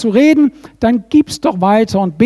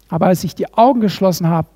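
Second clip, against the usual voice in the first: normal talking voice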